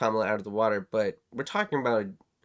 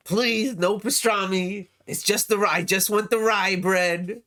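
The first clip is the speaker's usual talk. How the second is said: a higher New York deli whine, slow; New York deli customer; 7 semitones higher, slower than usual. Acted. Host mocks his Trump as a New York deli customer. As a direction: fuss over the rye like a New York regular.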